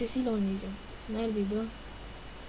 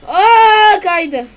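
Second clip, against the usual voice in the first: sudden high, loud shriek of surprise; surprised cry; 9 semitones higher, 16 dB louder than usual. Real, not acted. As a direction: yelp as the car slides out. Sudden slip in the game. (ani şaşkın çığlık)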